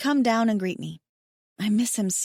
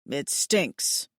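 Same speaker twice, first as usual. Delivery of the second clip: a hint of a lower male voice; lightly voiced alien mate; 7 semitones lower than usual. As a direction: grumble it like the big mate. Hayden, alien mate, speaks.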